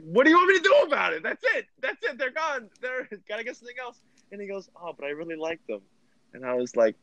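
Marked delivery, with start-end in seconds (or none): 0.0-4.0 very high, hammed-up exasperation; exasperated. Storyteller telling his drunk friend none are left, exasperated. 4.8-5.8 imitating a pleading child, soft and higher; small, soft childlike protest; little child. Drunk friend pleading for his favourite drink, childlike. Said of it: "he just sounded like a little child".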